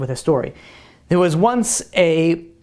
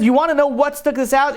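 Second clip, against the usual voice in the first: high-pitched retold rebuke; acted-out indignation, raised pitch; indignant soldier; 8 semitones higher than usual. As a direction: scold him with the pitch held high. Soldier confronting the crying smuggler.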